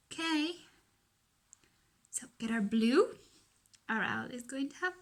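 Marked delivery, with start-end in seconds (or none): none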